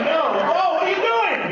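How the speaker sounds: very high, loud shriek; hammed-up panic; mock shrill alarm. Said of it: "it's like".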